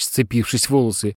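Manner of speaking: plain reading voice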